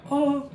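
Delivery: high, mock-tearful "oh"; emotional "oh". Streamer mimics her reaction to kindness, emotional "oh". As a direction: imitate yourself welling up at kindness. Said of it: "get emotional about anything".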